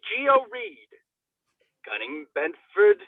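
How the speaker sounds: ordinary talk, nothing marked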